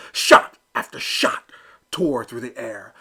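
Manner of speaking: plain reading voice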